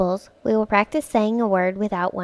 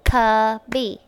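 "cubby" in two beats, cub, bee; broken into syllables; 4 dB louder than usual. Shown as a demonstration. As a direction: pause between the two parts of "cubby". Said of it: "cubby has two syllables".

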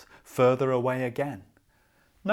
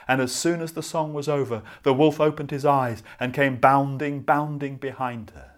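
fast, louder chase narration; excited narration; 3 semitones higher, 7 dB louder than usual. A narrator's reading. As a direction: rush it like the wolf pounces. Wolf chases the girl.

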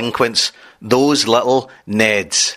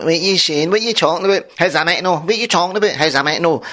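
higher, whiny Ned squabble; arguing Neds; 6 semitones higher, faster than usual. Retold or imitated. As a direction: imitate a Ned family bickering. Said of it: "they were all arguing".

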